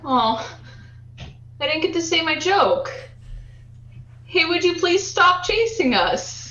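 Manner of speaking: mock polite. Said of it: "I'd be like".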